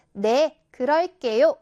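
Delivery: loud, confident reply; 자신감 넘치는 목소리. Shown as a demonstration. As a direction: answer up loud and sure of yourself. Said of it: "자신감 넘치고 큰 목소리로". (자신감 넘치고 큰 목소리)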